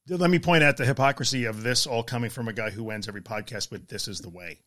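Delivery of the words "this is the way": lower, softer solemn catchphrase; Mandalorian catchphrase. Retold or imitated. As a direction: intone it like the Mandalorian. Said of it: "obsessed with The Mandalorian".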